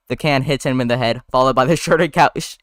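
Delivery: ordinary delivery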